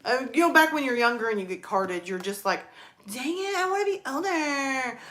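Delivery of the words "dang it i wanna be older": high put-on whine of a teen; higher-pitched pretend grumble; mock youthful frustration. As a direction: imitate a kid annoyed at being carded. Acting out her younger self carded.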